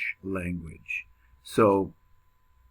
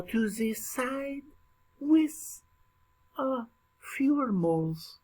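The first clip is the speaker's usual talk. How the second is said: hammed-up French accent, pitch climbing much higher; a teacher clowning in a fake French accent; mock French accent; 6 semitones higher, slower than usual. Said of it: "I could do the rest of the lecture in a French accent".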